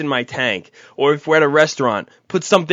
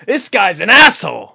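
raised, louder indignant quote; higher, louder mock outrage; all-caps reading; 4 semitones higher, 5 dB louder than usual. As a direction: read it out like it's typed in capitals. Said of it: "in all caps".

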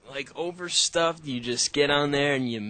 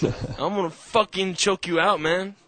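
higher-pitched hammed-up threat; mock tough fighter; 5 semitones higher than usual. Angry fighter warns the seat thief, mock threat.

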